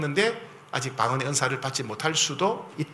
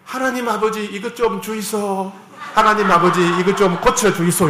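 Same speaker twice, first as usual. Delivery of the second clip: higher, pleading prayer in Gyeongsang dialect; 5 semitones higher, 6 dB louder than usual. Retold or imitated. Pastor imitates an African believer praying in Gyeongsang dialect. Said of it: "경상도 말 들었는데".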